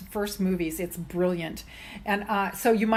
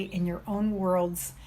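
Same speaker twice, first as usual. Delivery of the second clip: usual speaking voice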